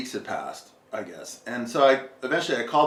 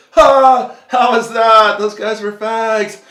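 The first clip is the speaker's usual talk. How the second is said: much higher, much louder, laughing through it; laughing mockery; 12 semitones higher, 15 dB louder, much slower than usual. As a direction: crack up at the strangers as they leave. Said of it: "busted up laughing".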